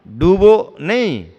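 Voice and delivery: डूबो drawn out loud, then a break before नहीं; slow, loud डूबो set apart from नहीं; emphatic phrasing. Shown as a demonstration. Preacher showing how a comma changes meaning, emphatic phrasing. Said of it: "डूबो के यहाँ कामा लगा दोगे".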